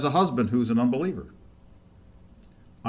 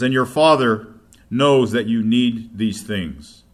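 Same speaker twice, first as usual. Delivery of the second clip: louder, slowed reading of Father knows; slow emphatic scripture; 7 dB louder, slower than usual. Acted. Preacher stressing God's care, slow and emphatic. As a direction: let each word land. Reads Jesus' words.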